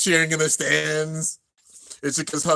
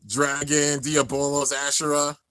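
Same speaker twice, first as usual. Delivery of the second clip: ordinary talk, nothing marked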